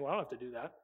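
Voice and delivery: low, dismissive inner voice; self-excusing mutter. Retold or imitated. The preacher's inner voice excusing a sin, low and dismissive. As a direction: brush it off to yourself.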